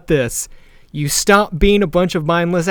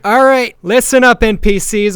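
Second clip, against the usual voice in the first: raised, louder announcing voice; crowd address; 6 semitones higher, 5 dB louder than usual. Acted. Rider addresses the crowd from above, loud call for attention. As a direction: get a whole crowd's attention.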